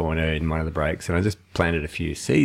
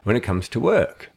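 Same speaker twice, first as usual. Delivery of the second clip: higher, louder, full of drive; passionate; 5 semitones higher, 3 dB louder than usual. Real, not acted. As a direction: sell your love for the work. Passionate about loving his work.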